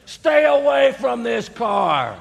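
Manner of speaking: slow, loud, drawn-out warning shout; slow commanding yell. Acted. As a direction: bark it out slowly as a stern warning.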